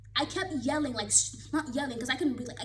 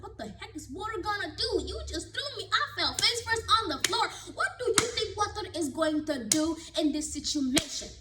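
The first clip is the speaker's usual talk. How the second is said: indignant retort; 8 semitones higher than usual. Retold or imitated. Her indignant inner retort.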